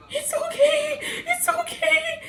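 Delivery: very high imitated squeal; exaggerated falsetto reassurance, breaking into laughter; mock high-pitched reassurance. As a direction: squeal it out as hammed-up comforting. Acts out Maddie's frantic reassurance.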